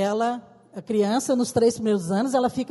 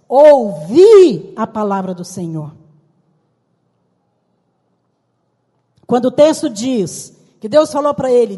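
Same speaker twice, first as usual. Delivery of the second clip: louder, emphatic pulpit delivery; fervent preaching; 3 semitones higher, 9 dB louder than usual. Acted. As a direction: preach it out to the congregation. Preaching at full force. (pregação fervorosa e alta)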